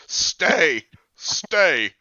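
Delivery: loud, short order to a dog; mock dog command. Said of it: "a dog now?".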